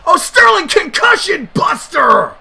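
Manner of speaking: high, loud, stretched move call; excited shout. Real, not acted. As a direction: yell the move out in amazement. Calling a big move.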